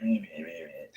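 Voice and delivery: "my bad, my bad" whispered, low and very soft; whispered apology. Real, not acted.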